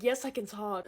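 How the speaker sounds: ordinary talk, nothing marked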